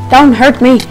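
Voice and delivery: ordinary talk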